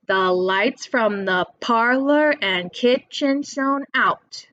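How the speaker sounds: slow sing-song with four beats, LIGHTS, PARLOR, KITCHEN, OUT; slow demonstration of the poem's meter; stressed syllables marked. Shown as a demonstration. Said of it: "That's four".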